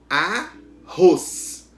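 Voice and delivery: arroz split in two, with a loud roz; slow arroz, hitting roz hard; stress on ROZ. Shown as a demonstration. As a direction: stretch arroz and punch the last syllable. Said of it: "Qual é a sílaba que pronunciou com mais força?".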